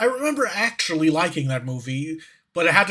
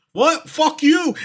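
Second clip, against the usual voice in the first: much higher, louder outraged comeback; indignant mock yell; 12 semitones higher, 7 dB louder, slower than usual. British guy answering the mimic, hammed-up indignant yell. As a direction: squawk it back in mock outrage. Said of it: "And then he's all".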